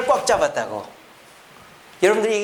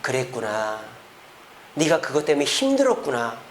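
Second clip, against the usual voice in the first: gentle empathy; 5 semitones lower than usual. Shown as a demonstration. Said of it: "따라해봅시다".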